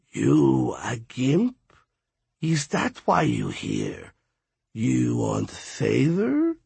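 slow, raspy skeleton voice; deep raspy voice. A narrator's reading. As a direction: drag the words out, rasping. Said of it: "with a deep, raspy voice".

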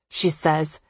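usual speaking voice

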